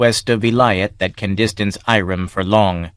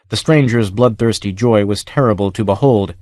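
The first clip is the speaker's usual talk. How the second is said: ordinary delivery, nothing marked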